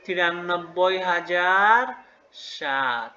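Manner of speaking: very slow "তিরানব্বই হাজার", long pause, then "সাত"; drawn-out pronunciation demo. Shown as a demonstration. Teacher shows how the number is pronounced, slow and drawn out. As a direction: stretch "হাজার" out, pause, drop onto "সাত". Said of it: "তার মানে উচ্চারণ কি হলো".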